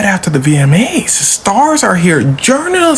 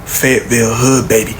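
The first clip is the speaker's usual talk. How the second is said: imitating [name]; 8 semitones lower than usual. Cole answering [name], low proud imitation. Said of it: "Jermaine go".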